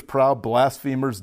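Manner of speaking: usual speaking voice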